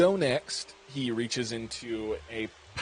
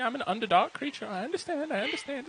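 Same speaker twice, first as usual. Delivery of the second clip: high, wounded little voice trying to sound okay; hurt, pretending he's fine; 13 semitones higher than usual. Jilted cook to his date, high wounded pretend-fine voice. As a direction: squeak out that it's fine while clearly hurt. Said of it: "you see his eyes start to tear up".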